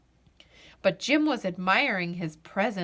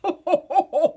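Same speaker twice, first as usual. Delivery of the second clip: loud, hearty Santa laugh; a big belly laugh; jolly laugh; 8 semitones higher, 6 dB louder than usual. A narrator's reading. Santa laughing at the captain's question, hearty jolly laugh. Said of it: "Santa Claus laughed".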